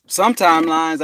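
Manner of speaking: ordinary delivery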